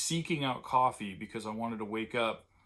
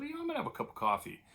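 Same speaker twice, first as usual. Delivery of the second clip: much higher, fast self-imitation; mock announcement; much faster than usual. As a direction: squeak it out quickly, mocking your younger self. Retelling own decision at work.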